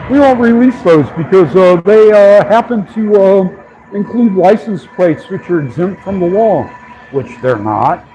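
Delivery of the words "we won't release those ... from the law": a hint of a stiff spokesman, pitched higher; lightly voiced company line with hesitant uhs; mock official excuse. Vlogger imitating via refusing to release dash cams, higher mock official voice.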